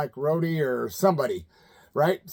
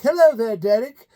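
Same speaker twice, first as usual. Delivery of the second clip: high, loud, sing-song 'Hello there, Derek'; Sharon impression; 6 semitones higher, 7 dB louder than usual. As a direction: greet the caller sweetly like a talk-show guest. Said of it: "And she's like".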